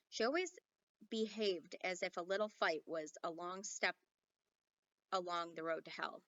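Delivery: ordinary delivery, nothing marked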